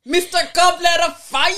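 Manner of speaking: high and loud; announcer shout. Pretend. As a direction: call it out like a ring announcer. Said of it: "so that I can do it properly".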